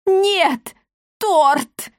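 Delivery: high-pitched wail of horror; theatrical terror, each word cried out; horrified cry. A narrator's reading. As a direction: shriek it out, pitched right up. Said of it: "в ужасе закричала она".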